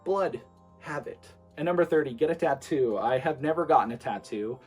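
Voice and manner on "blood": ordinary talk, nothing marked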